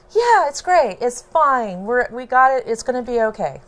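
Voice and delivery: high and loud, overly upbeat; eager reassurance. Retold or imitated. Reassuring her sister about the baby, high and loud. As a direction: insist it's all fine, brightly.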